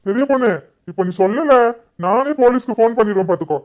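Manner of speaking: loud, forceful demand; angry threat. Acted. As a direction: threaten them until they confess. Son threatens police, demands answers.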